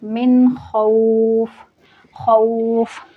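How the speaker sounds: slow chant, खौफ ending in sukun; tajweed recitation. Shown as a demonstration. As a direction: stretch मिन, stop cleanly on खौफ. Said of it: "ऐसे पढ़ रही हूँ". (खौफ पर सुकून लगाकर धीमी तिलावत)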